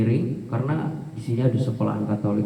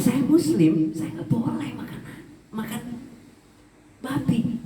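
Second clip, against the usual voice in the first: tearful breaking voice; 10 semitones higher than usual. Real, not acted. Recalls eating pork, breaks down.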